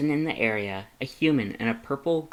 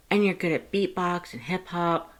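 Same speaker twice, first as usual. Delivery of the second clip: cheerful Frisk voice; 7 semitones higher than usual. Acted. Frisk telling Lust about college, high and bubbly. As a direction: brag happily to a friend.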